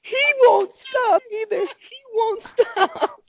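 very high, slow wheezing through laughs; helpless laughter. Real, not acted. Host can't stop laughing at Corey, squeaky wheeze.

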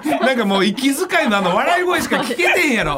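high, slow, laughing through words; words squeezed out between laughs; laughing while talking. Real, not acted. Host describes the laughter, laughing.

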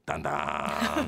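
low, drawn-out ominous catchphrase; comic doom-laden delivery; mock foreboding. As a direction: stretch it out slowly, deep in your range.

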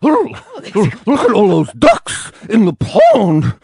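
high, slow squeaky dog rapper; rapper bulldog voice. Pretend. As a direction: play a chilly bulldog rapper. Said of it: "could you do a bulldog's voice?".

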